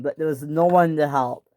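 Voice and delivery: ordinary delivery, nothing marked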